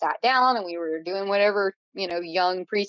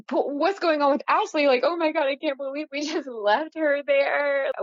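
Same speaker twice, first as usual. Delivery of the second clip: higher, mock-panicked retelling; retold freak-out; 6 semitones higher than usual. Friends panicking about leaving Ashley behind, pitched-up mock alarm. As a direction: replay the group's panic, pitched up.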